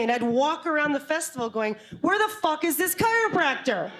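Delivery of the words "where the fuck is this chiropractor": high-pitched mock exasperation; pissed off. She hunts the festival for the chiropractor, acting pissed off. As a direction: demand it, comically annoyed. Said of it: "And then I'm pissed off".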